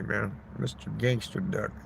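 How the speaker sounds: ordinary talk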